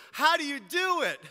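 high, loud pleading question; impassioned plea. Real, not acted. Pastor pressing the congregation, high and loud. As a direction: cry it out to the room.

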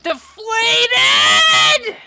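high, loud screech at an opponent; outraged screaming protest; enraged yelling. Real, not acted. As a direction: shriek the insult at the game.

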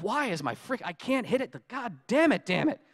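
high, exasperated grumbling at a door; mock frustration, cursing the lock; frustrated cursing. Frustrated self at stuck door.

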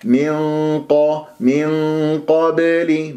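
من قبل with thick hum and heavy q; match the dark hum of من to a full قبل; heavy ghunnah and qāf. Shown as a demonstration. Teacher corrects the error, heavy nasal and qāf. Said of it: "إخفاء بغنة مفخمة بمرتبة القاف ثانية".

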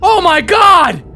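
high loud shocked cry; shocked gasp. Real, not acted. Shocked by monster.

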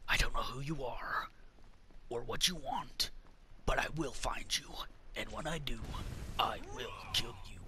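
low whispered menacing threat; Liam Neeson voice. Retold or imitated. Gamer threatening an enemy player in a Liam Neeson voice. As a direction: breathe the threat low and quiet like a vengeful ex-agent. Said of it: "I have to do that in the, the Liam Neeson voice".

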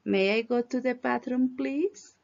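whole question in one flow, rising on please; question tune. Shown as a demonstration. Teacher modelling the question for the class, question tune. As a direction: ask it like a real question, please lifted. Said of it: "También con entonación, porque es pregunta".

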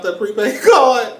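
laughing through the words. Real, not acted. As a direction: chuckle while telling it. Laughs mid-story.

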